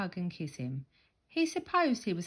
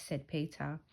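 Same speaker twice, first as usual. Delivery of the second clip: plain reading voice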